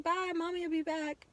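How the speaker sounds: high, tearful retelling; close to tears. Real, not acted. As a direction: push on through the story while holding back crying. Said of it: "I'm ready to fucking cry".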